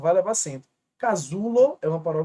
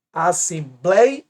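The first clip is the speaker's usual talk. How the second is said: "Assembleia" in slow separate syllables, BLEI louder; stress on BLEI; 5 dB louder, much slower than usual. Shown as a demonstration. Teacher showing the diphthong in the penultimate, slow stress on BLEI in "Assembleia". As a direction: draw out and lift BLEI in "Assembleia". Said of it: "Um ditongo na penúltima".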